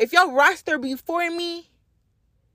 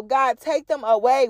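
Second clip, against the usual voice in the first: usual speaking voice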